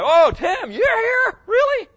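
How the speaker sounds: very high, loud mock amazement; astonished greeting. Preacher meeting Brother Tim in heaven, astonished. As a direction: squeal in disbelief at who turned up.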